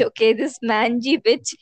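ordinary talk, nothing marked

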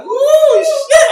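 very high, loud, laughing cheer; excited whoop. Retold or imitated. Retelling a child's joy at mom's spoiling, excited high whoop. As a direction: whoop it out, delighted. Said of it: "I'm like".

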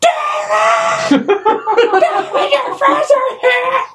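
high, slow, shrieking call upstairs; hammed-up mom bellowing for her son; shrill mom yell. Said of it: "she's like".

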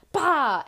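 sudden mock scare shout; jump-scare shout. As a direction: burst out as if startling someone. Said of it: "he comes up behind me. He goes".

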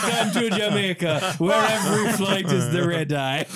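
a hint of mock Jamaican, laughing; lightly accented, laughing through it; playful mock Jamaican quote; quoting Mitch Hedberg. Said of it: "To quote Mitch Hedberg".

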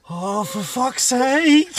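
loud, high, drawn-out mock wail; retold bawling. Dad retelling his breakdown over daughter's first word, mock bawling. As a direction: wail it in hammed-up tears. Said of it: "absolutely bawled into tears".

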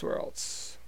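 whispered aside, barely voiced; a breathy whispered afterthought; whispered to himself. Real, not acted. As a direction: drop to a whisper as you think. Muttered repeat to himself.

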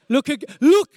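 loud urgent warning from heaven; urgent shout. Retold or imitated. Voice from heaven warns him of the wave, loud and urgent.

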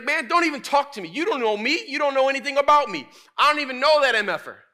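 high, full-volume shouted denial, hammed up; furious Peter. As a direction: yell them off in mock outrage. Acts Peter's final furious denial.

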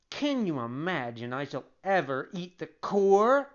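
lightly acted childish indignation; mild rage. A narrator's reading. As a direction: protest with a touch of outrage. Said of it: "turning on him in a rage".

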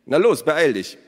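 loud, high command to hurry; sharp hurry-up call; urging order. A narrator's reading. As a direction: send the boy off briskly. Father urges son to run.

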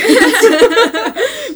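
high, loud burst of laughter; big real laugh. Real, not acted. Laughs at own lack of expectations.

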